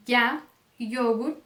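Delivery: ya, yogur with a tight glide like a closed I. Shown as a demonstration. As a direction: open ya and yogur on a narrow Y glide. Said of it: "pode pronunciar ele como". (Y como I fechado em ya, yogur)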